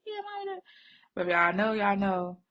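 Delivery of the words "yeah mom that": put-on high, soft dismissive teen; mock indifference. Grown daughter mimicking her teen self to mom, high and offhand. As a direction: brush off mom lightly. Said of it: "So I'm like".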